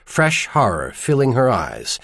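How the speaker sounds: ordinary delivery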